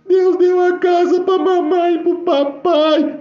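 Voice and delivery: high, slow, loud wail; crying girl. Retold or imitated. Girl announcing a house for her parents, crying. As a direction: imitate a child sobbing out joyful news. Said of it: "Chorando, chorando, chorando".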